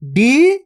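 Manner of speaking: "डी" held long, rising; drawn-out vowel. Shown as a demonstration. Teacher sounding out a word for learners, drawn-out "डी". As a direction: stretch the ee of "डी" upward. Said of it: "खींच के पढ़ेंगे कैसे?".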